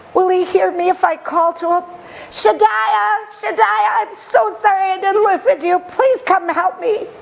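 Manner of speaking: put-on high, pleading boy calling out; desperate calling. Lost boy calling to Shaddai, high and pleading. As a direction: cry out for help, high and desperate.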